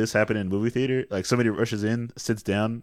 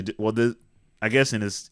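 ordinary talk, nothing marked

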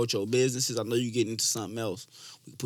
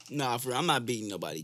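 ordinary talk

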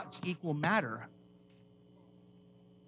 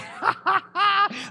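delighted laugh; 13 semitones higher, 5 dB louder than usual. Real, not acted. Delighted at discovery in verse.

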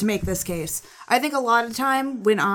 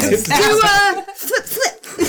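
high squeaky voice; 12 semitones higher, 6 dB louder, much slower than usual. Acted.

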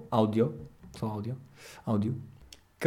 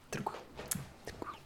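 whispered reassurance; 18 dB quieter, faster than usual. Real, not acted. Fading whisper to nothing.

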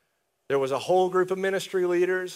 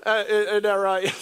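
laughing; 3 semitones higher, 3 dB louder, slower than usual. Real, not acted. Laughing as he teases Stephen.

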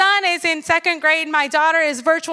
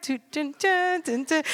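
dramatic dun dun; 7 dB quieter than usual. Pretend. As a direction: hum the ominous dun dun. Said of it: "dramatic sound effect".

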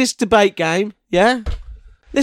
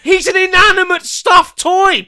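loud, high-pitched protest, words drawn out; high exasperated yell; exasperated shout; 11 semitones higher, 4 dB louder than usual. Real, not acted. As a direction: shout it in indignant disbelief. Exasperated argument over the toy.

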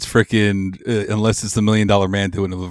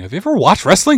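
raised-pitch disbelieving question; incredulous challenge; 8 semitones higher, 4 dB louder than usual. Real, not acted. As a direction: challenge your co-host, voice lifting. First incredulous challenge to co-host.

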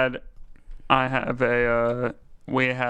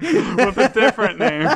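laughing and louder; laughing higher and louder; 10 semitones higher, 6 dB louder than usual. Real, not acted. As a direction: giggle through the correction. Corrects himself laughing.

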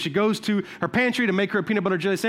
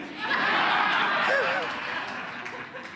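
laugh; 13 semitones higher, 5 dB quieter than usual. Real, not acted. Preacher laughing at punchline.

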